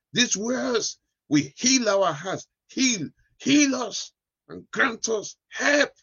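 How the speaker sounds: high pleading prayer; high, slow fervent plea. Real, not acted.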